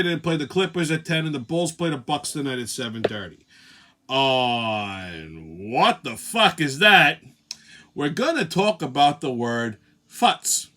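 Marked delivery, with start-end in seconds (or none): 4.1-7.2 drawn-out, very slow segment title; segment announcer. Acted. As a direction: stretch it out like a show announcer.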